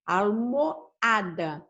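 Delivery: "almoada" slowly split, almo, then ADA; stressed A in almoada. Shown as a demonstration. Teacher shows where the tonic syllable is, "almoada" slowly divided at A. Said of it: "A sílaba tônica está aqui".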